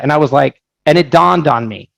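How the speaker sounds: ordinary delivery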